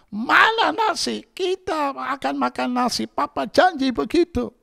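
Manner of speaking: put-on high, loud child's voice; child demanding. Hungry child demanding rice from papa, high and loud.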